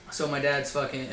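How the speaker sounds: normal talking voice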